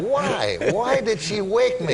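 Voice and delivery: high-pitched, drawn-out complaint; mock protest. Boy who didn't want to leave that dream, high mock protest. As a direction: wail a little in playful dismay at being woken. Acts out his boyish protest.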